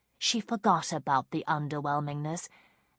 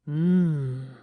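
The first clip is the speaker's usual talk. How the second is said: appreciative hum; 6 dB louder than usual. A narrator's reading. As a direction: hum with pleasure. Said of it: "he said".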